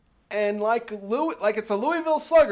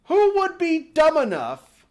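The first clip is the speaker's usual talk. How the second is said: louder, higher disbelief; incredulous; 6 semitones higher, 4 dB louder than usual. Real, not acted. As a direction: throw it at the crowd, baffled. Incredulous rant at the audience.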